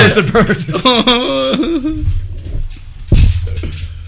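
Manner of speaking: high burst of laughter. Real, not acted. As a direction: crack up laughing. Laughing at drunk remark.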